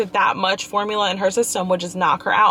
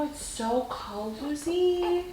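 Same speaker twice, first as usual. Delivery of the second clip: very soft, very slow, higher sing-song "cozy"; soothing; 5 semitones higher, 9 dB quieter, much slower than usual. Real, not acted. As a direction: coo "it's so cozy" up high and drawn out. Mother swaddling fussy baby.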